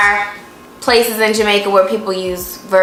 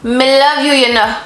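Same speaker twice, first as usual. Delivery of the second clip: louder Jamaican "Me love you"; learner's Jamaican try; 4 semitones higher, 3 dB louder than usual. Shown as a demonstration. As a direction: copy the Jamaican phrase eagerly. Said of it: "You gotta have the "you know".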